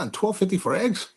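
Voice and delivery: rising, incredulous question; mock disbelief. Narrator voicing his own disbelief at the egg price. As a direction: ask it like it's absurd. Retelling his shocked reaction.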